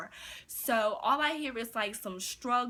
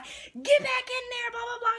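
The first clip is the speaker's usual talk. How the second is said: high, loud imitated yell; 13 semitones higher, 7 dB louder than usual. Manager sending cashier back into the room, high retold yell. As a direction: shriek it like a panicked manager giving orders. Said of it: "she just had yelled at me".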